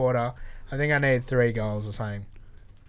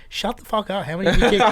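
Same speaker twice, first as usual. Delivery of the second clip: mate; 8 semitones higher, 7 dB louder than usual. Retold or imitated. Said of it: "He's like".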